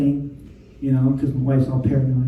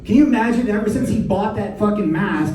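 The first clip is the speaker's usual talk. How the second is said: ordinary talk, nothing marked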